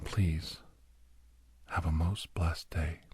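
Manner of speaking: usual speaking voice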